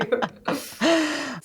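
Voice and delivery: short laugh. Real, not acted. Laughs at her own joke.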